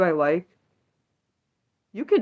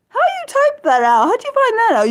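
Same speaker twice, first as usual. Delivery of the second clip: very high, squeaky astonishment; astonished; 4 dB louder than usual. Real, not acted. Streamer marveling at how chat typed the name, squeaky astonishment. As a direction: marvel out loud at it. Disbelief at the spelling.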